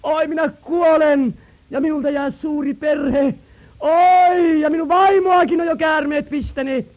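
a high, loud, lightly voiced cry of a dying man; preacher voicing a dying man, mildly wailing; dying man's cry. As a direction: wail it out as the dying father. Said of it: "joku mies huutaa".

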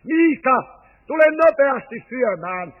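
loud call from an upstairs window, falling on the name; grandma calling down to the yard for dinner. Retold or imitated. Grandma calls the boy from upstairs, loud call. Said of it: "ja huutaa".

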